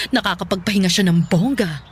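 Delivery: usual speaking voice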